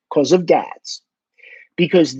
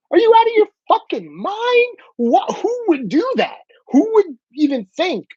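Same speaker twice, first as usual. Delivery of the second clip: much higher, exasperated disbelief; incredulous outburst; 9 semitones higher than usual. Real, not acted. Host ranting at kids who fought a cop, incredulous. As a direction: demand it in high-pitched, swooping disbelief.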